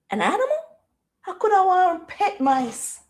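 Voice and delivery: ordinary delivery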